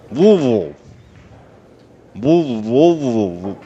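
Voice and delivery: put-on garbled, swallowed Louisville accent, loud; exaggerated, slurred Louisville-accent imitation; mock Louisville accent. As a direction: mush the town's name into a Louisville mumble at full volume. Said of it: "That's what they sound like".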